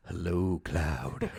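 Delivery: mock Sephiroth. The villain greeting the hero, low and hushed. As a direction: drop low and quiet, like a lurking villain. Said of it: "he has, like, a fucking conversation where he's like".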